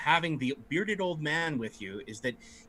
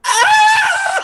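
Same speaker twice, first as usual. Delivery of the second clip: shrill high shriek; 14 dB louder than usual. Acted. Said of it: "and the kid goes".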